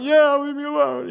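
brushing mom off. Retold or imitated. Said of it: "I'm like".

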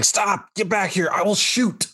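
high-pitched shouted command; marshal yelling. Acted. Game master as marshal yells at a fleeing player, acted yell. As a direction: yell a warning after someone running away. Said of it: "the marshal behind you is yelling".

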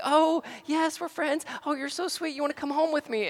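put-on high, sugary baby-talk voice; cooing lady. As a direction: coo at a toddler like a sweet cashier.

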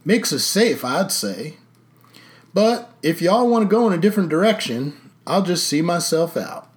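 put-on old Texas sheriff. A narrator's reading. Sheriff finishes his speech.

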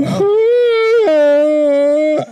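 long high wobbly moan into a glass; dying calf. Pretend. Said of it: "That sounds like a dying calf".

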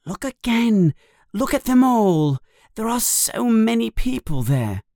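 put-on high-pitched eager boy's voice; excited. A narrator's reading. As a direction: urge them excitedly, pitched well up. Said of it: "says Harry excitedly".

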